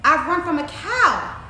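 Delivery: high, sing-song boastful taunt; taunting gingerbread man. A narrator's reading. Gingerbread man brags to the muddy old sow, taunting. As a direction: crow it playfully, stretching the key words. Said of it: "put his hands up on his hips and said".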